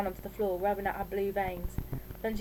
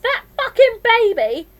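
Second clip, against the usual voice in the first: high, loud, drawn-out spite; enraged teenage outburst; furious cursing; 14 semitones higher, 15 dB louder, slower than usual. A narrator's reading. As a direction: spit it out at full volume. Furious daughter curses the baby.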